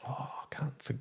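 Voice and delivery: a hushed aside mid-memory; whispered to self. Real, not acted. Podcast guest whispers to himself while forgetting a name. Trying to recall a forgotten name.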